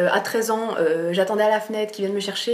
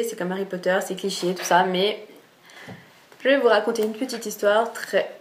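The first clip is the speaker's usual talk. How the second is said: ordinary talk, nothing marked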